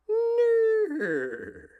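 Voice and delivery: very high, loud whinnying no; drawn-out whinny on no; horse neigh. Acted. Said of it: "A horse says".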